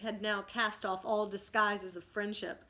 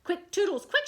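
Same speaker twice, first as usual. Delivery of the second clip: much higher, loud urging; urgent scream; 10 semitones higher, 8 dB louder than usual. Acted. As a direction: hurry him on shrilly. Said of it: "she screamed".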